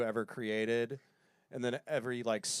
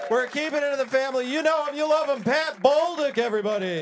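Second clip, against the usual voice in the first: high, loud announcer introduction; emcee hype; 11 semitones higher, 6 dB louder than usual. Real, not acted. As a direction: sell the next act to the room.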